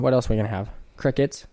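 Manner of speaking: normal talking voice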